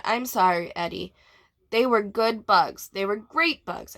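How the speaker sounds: ordinary delivery, nothing marked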